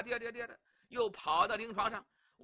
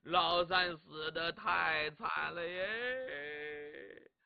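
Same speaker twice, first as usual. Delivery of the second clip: slow, lower drawn-out lament; hammed-up keening with a very long last syllable; fake mourning wail; 4 semitones lower, much slower than usual. Cheng telling officers third brother died, slow stagey wail.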